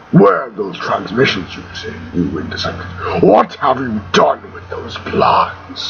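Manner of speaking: deep, menacing villain growing breathier; Darth Vader. Acted. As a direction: do a dark lord's deep, airy voice. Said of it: "eh, Vader impression".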